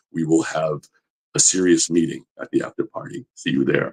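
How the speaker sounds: very low, soft, solemn voice; deep, hushed, measured authority; Cary Tagawa impression. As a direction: pronounce it quietly like a villain summoning you. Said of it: "he's got that voice".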